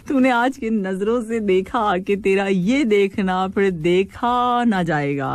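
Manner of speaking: slow Punjabi-accented recitation. Shown as a demonstration. Host reading a listener's sher slowly in a Punjabi accent. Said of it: "Your Punjabi accent is nice".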